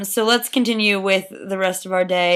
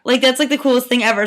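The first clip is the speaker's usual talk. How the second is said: usual speaking voice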